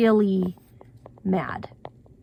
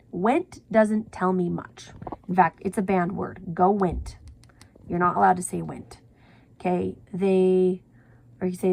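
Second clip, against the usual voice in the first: normal talking voice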